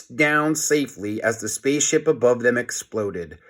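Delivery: plain reading voice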